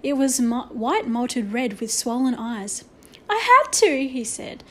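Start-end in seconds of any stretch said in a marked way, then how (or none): 3.3-4.1 high, loud defensive outburst; agitated protest. A narrator's reading. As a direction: protest it, pitched right up. Patrick defends himself after the rebuke.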